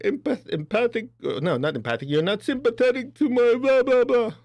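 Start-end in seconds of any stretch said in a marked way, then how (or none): 2.1-4.3 mock complainer. Mimicking a complaining person.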